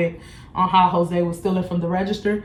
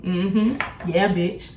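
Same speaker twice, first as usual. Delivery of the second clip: usual speaking voice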